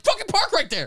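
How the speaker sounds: high, strained, furious insistence; exasperated mock yell. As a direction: re-enact losing patience with the driver. Retold parking argument escalates.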